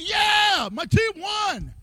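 high mock victory shout; a happy fan's yell, then slowing; fan cheering. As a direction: cheer like your team just won. Fan cheering their team.

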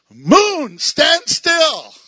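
loud, high command to the sky; commanding shout. Retold or imitated. Preacher voicing Joshua shouting at the moon. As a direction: call out boldly to the moon.

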